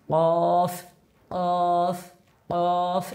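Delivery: কফ with a full, heavy sound; heavy letter. Shown as a demonstration. Teacher showing students the heavy কফ. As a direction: give কফ a fuller, heavier sound. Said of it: "একটু আওয়াজটা মোটা হবে".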